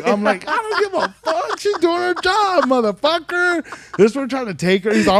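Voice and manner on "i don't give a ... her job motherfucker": heated retort. Retold or imitated. Retold retort to homie.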